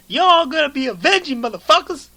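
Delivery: loud, high-pitched tough-guy movie yell; Samuel L. Jackson. Acted. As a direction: belt out a hammed-up action catchphrase. Plays Samuel L. Jackson as Fury.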